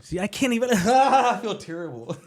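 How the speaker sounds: high-pitched real laughter through the words; laughing helplessly. Real, not acted. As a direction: crack up, stretching the words out. Cracks up attempting an accent.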